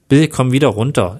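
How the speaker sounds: usual speaking voice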